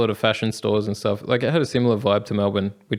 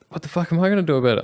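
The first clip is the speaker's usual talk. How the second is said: higher, fast and incredulous; startled outburst; 7 semitones higher, much faster than usual. Retold or imitated. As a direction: rattle it off, half-awake and baffled. Startled reply to odd request.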